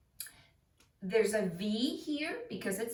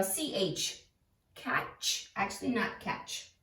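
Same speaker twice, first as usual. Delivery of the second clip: ordinary talk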